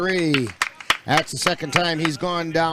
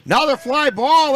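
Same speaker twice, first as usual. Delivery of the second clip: sudden, much higher and louder burst of play-by-play; excited call; 9 semitones higher, 8 dB louder than usual. Real, not acted. Radio announcer calling a fly ball to listeners, excited. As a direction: jump on the fly ball with a loud, high call.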